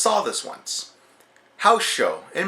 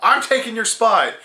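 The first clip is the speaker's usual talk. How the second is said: higher, indignant shouting as The Miz; mock angry Miz; 7 semitones higher than usual. The Miz shoves Sandow aside, mock angry. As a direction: snap at Sandow like a furious heel. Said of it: "The Miz stops and he's like".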